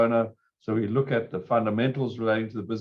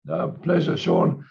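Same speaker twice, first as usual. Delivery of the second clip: hoarse, scratchy throat; croaky voice; 6 semitones higher, 3 dB louder, slower than usual. Real, not acted. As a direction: speak through a rough, croaky throat. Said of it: "Excuse my croaky voice".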